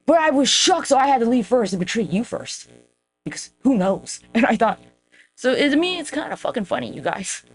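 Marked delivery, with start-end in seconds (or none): 0.1-4.2 lightly voiced ex, pitched higher, put-on innocence; a hint of the ex playing dumb and wounded; mocking the ex. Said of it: "My ex would be like".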